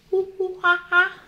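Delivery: high, loud hoo-hoo-ha-ha like a monkey; monkey call. Shown as a demonstration. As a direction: hoot up high for the baby like a monkey. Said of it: "What does a monkey say?".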